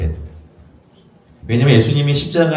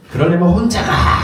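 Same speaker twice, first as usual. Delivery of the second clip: mock angry shout; 7 semitones higher, 3 dB louder than usual. Wise men quarrelling on the road.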